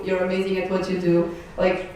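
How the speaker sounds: ordinary delivery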